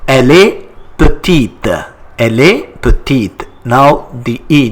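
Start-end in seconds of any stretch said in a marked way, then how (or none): 0.1-3.5 petite with the last T clearly released; sounded final T. Shown as a demonstration. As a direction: model "petite" in French, ending on T. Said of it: "notice the E at the end".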